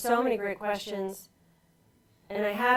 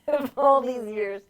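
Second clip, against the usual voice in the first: laughing through the words, slowed; amused laugh breaking into speech; 4 semitones higher, 6 dB louder than usual. Real, not acted. Laughing at husband's odd phrase.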